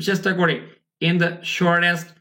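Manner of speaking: usual speaking voice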